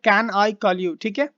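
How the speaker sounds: ordinary talk, nothing marked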